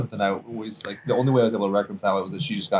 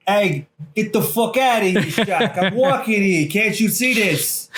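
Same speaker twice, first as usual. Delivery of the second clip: loud, high, laughing New York tough guy; hammed-up New York accent, louder and higher; 10 semitones higher, 4 dB louder, slower than usual. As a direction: bark it like a cabbie from New York. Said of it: "I wish that was the way he talked".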